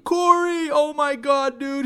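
high, thrilled imitation of a fan; excited greeting. Staff greeting a favourite regular, high and excited. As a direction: gush as if spotting a celebrity friend.

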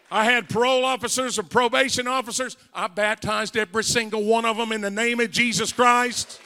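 fired-up pulpit shouting; full-force preaching. Real, not acted. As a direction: proclaim it forcefully to the whole church.

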